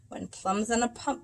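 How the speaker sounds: ordinary delivery, nothing marked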